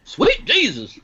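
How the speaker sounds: high-pitched mock preacher cry; mock pulpit preacher. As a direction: imitate a Southern preacher calling out. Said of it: "back to the pulpit".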